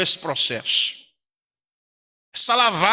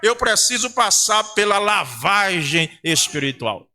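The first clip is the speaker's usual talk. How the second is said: higher-pitched, driving preacher's delivery; pregação fervorosa; 5 semitones higher than usual. Real, not acted. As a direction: preach it with mounting fervour to the congregation. Preaching at full force. (pregação inflamada)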